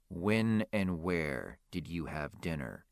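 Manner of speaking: slow, patient and a little weary. A narrator's reading. Cop patiently rephrasing for a witness, slow and measured. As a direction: lay it out slowly like to a civilian. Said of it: "Patiently, long-suffering professional to civilian".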